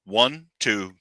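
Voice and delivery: ordinary talk, nothing marked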